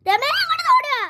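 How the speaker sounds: high, loud call across the house; grandma shouting for Rema to hurry over; urgent call. Acted. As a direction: summon her to come running. Grandma calls daughter over.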